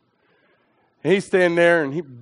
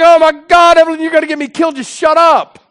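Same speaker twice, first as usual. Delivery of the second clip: high, loud panicked plea; frantic inner panic; panicked thought; 10 semitones higher, 9 dB louder than usual. Retold or imitated.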